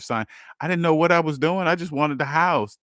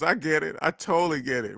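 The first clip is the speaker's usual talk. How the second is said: normal talking voice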